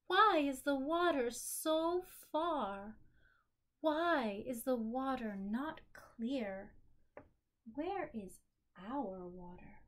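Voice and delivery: expressive picture-book read-aloud; story narration. A narrator's reading. Reading her picture book aloud to a listening child, expressive storytelling.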